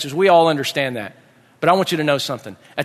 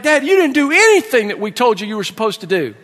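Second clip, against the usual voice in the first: high mock-wailing kid; acted tearful outrage, pitched up; crying complaint; 6 semitones higher, 4 dB louder than usual. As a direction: protest to Dad like an upset child. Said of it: "they're just back there crying".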